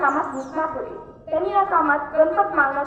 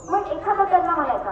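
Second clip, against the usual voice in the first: usual speaking voice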